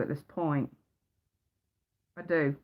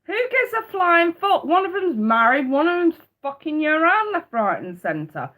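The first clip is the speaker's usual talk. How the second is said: heated rant; 7 semitones higher, 4 dB louder than usual. Real, not acted. Ranting about the men.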